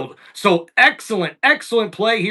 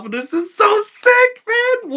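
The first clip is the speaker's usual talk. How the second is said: excited outburst; 12 semitones higher than usual. Real, not acted. Excitement breaks through.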